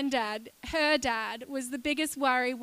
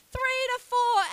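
"Three to four" pitched higher, pushed to sell the surprise; emphatic repeat; 9 semitones higher, 4 dB louder than usual. Real, not acted. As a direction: lift "Three to four" to get a reaction. Repeating for the shocked response.

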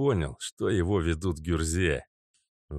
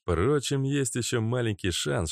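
ordinary delivery

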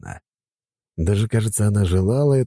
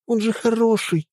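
put-on pleading girl. A narrator's reading. Helga pleading.

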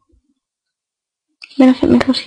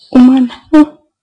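ordinary delivery

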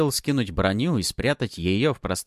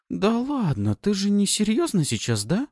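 offended whine; 12 semitones higher than usual. Acted. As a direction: sulk it out hurt. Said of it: "обиженно протянула Пафи".

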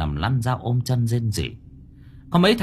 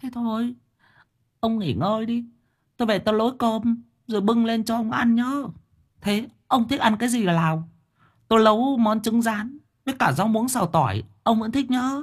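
wife fussing; 12 semitones higher than usual. A narrator's reading. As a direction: chatter on about dinner, oblivious. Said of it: "Bà quay đi mà lẩm bẩm". (vợ già lẩm bẩm giọng cao)

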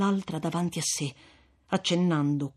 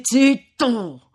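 loud high command; sharp silencing cry; 7 semitones higher, 9 dB louder than usual. Acted. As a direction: cut him off hard. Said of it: "interruppe il frate". (zitto gridato, secco)

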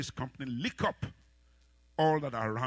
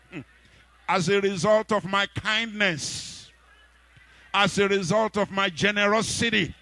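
loud, higher preaching prayer for the church to repeat; 6 semitones higher, 13 dB louder than usual. Acted. Preacher leads the church in prayer, preaching loud. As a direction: pray it out with rising force.

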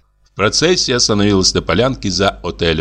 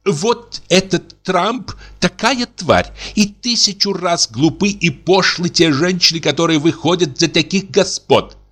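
indignant; 9 semitones higher than usual. Acted. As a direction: spit out contempt. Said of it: "негодующе прошлась по комнате".

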